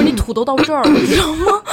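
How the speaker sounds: high-pitched exasperated rebuke; exasperated telling-off. Retold or imitated. Daughter warns sick mother about her age, exasperated telling-off. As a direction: drive the warning home, pitch climbing.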